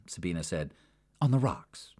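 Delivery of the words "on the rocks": ordinary delivery, nothing marked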